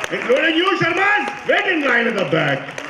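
high-pitched call to those behind; calling out orders. Retold or imitated. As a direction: imitate dad ordering drivers back. Dad calling to the drivers behind.

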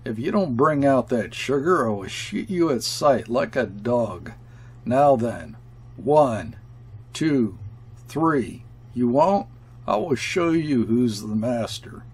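usual speaking voice

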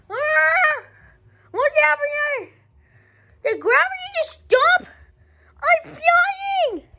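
squeaky high voice, shouting in panic; high-pitched yelling kid; high cartoon kid voice. Puppet character flying, screaming.

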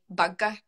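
"Burger" in a Scottish accent, vowel like "bug". Shown as a demonstration. Showing teammates how she and Carly say "Burger", Scottish accent. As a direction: say "Burger" with a Scottish rolled-r ending. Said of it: "everyone always gets me to say".

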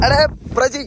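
loud call to a friend. Real, not acted. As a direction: shout his name across. Calling out to friend.

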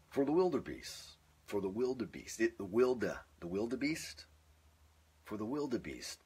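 trying the wildebeest line several ways; retaking the opening. Shown as a demonstration. Practising the wildebeest opening over and over. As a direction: keep restarting the line. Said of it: "that's just too long".

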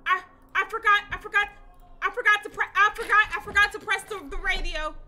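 high, loud panicked yelling; freaking out over a missed button. Real, not acted. Gamer to viewers, panicking about forgetting the radio.

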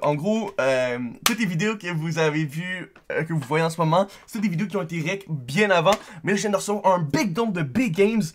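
loud, high excited streamer voice; hyped up. Real, not acted. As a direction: pump up the hype. Hyped about big donation.

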